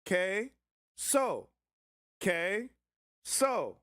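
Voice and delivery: "Que?" and "So." split apart as separate syllables; "Que?" then "So." with a pause between. Shown as a demonstration. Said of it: "the qui makes a hard K sound".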